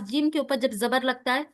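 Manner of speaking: ordinary talk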